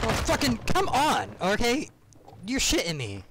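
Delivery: high, loud frustrated outburst; raging. Real, not acted. Gamer raging at the match, high loud outburst. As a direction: let the rage burst out.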